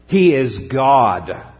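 He is God, slow and weighty, He hit hard; slow proclamation. Shown as a demonstration. Pastor driving the point home, slow and weighty. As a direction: let each word land. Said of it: "He is Jehovah".

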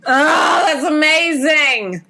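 high, slow, choked-up gush; high, stretched, near-tearful awe; delighted gasp. Real, not acted. Reacting to Rachel York story.

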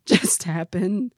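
slow, stunned did that really just happen; disbelieving. Retold or imitated. Wife retelling her reaction, slow stunned disbelief. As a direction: drag it out in disbelief. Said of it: "I was like".

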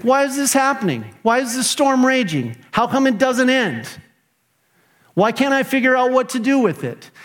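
high, exasperated whining; hammed-up frustrated why-questions; mock grumpy complaining. Preacher imitating his own grumpy complaints in storms. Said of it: "I get grumpy. Like".

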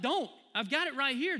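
God calling out high and loud; voicing God. Preacher voicing God warning his kids off the road, loud and urgent. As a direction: plead like a father shouting a warning. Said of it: "And God's like".

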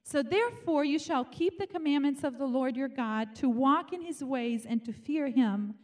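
ordinary talk, nothing marked

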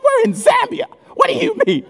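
very high squeaky disbelief; high-pitched, comic exasperation; incredulous. Acted. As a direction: protest in bewilderment, voice way up. Baffled reaction to pompous prayers.

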